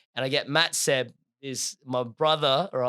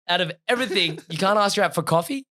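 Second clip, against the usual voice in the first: high-pitched retold indignation; indignant outrage; 5 semitones higher, 4 dB louder, faster than usual. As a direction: replay your outraged disbelief, pitched up. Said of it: "I was so mad in that moment".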